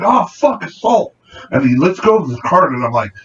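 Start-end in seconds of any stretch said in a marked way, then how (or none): 0.0-1.1 high mock cry of pain, clearly fake; hammed-up fake assault. Security guard fakes an assault to mock the thief, hammed-up cry. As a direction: overact being hurt by the cart.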